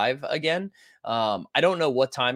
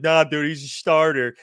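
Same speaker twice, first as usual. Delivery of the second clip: mock chat voice; 6 dB louder than usual. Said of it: "everyone's like".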